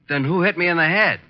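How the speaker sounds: outraged puzzlement; indignant outburst. Acted. Archie realizing a second man hit him, indignant outburst.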